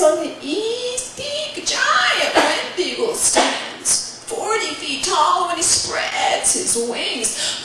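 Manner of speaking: put-on very high old woman, scolding then slow and hushed; exasperated grandmother. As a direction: give in wearily, then warn slowly. Grandmother gives in and warns.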